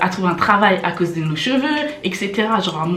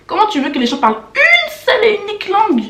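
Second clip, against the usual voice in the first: very high, incredulous squeal of a question; exasperated disbelief; 14 semitones higher, 5 dB louder than usual. Real, not acted. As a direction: pitch it way up, louder, in disbelief. Exasperated rant at accent request.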